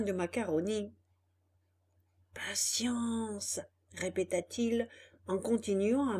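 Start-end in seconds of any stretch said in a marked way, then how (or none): 2.3-3.6 soft whispered to himself. Acted. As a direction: breathe it to himself. Donkey repeats to himself.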